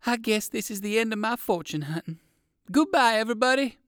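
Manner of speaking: high-pitched, doleful farewell; feeling badly. A narrator's reading. Rabbit says farewell expecting the worst, feeling badly. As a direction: bid a sorry goodbye, voice held high. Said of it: "he felt so badly".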